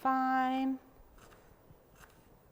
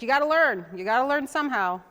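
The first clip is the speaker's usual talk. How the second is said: louder, firmer mom voice; stern mom; 4 semitones higher, 8 dB louder than usual. Retold or imitated. Mom telling her crying daughter to learn, stern and louder. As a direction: lay down the law to the crying kid. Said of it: "She'd be like".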